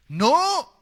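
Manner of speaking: high, loud outraged no; indignant no. Acted. Man denying the temptation, loud indignant no. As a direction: shout a shocked refusal. Imagined man rejects the idea.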